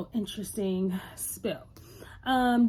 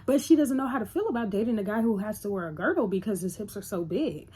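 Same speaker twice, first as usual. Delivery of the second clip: ordinary talk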